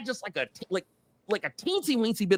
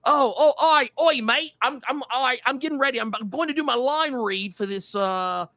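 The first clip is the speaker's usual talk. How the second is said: mock British accent; 5 semitones higher, 3 dB louder than usual. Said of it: "I don't know why I became Australian".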